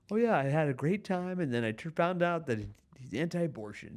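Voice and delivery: high, bubbly gush about a great date; gushing then deflated. Retold or imitated. A dater recounting her date, high excited gush. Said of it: "of just like".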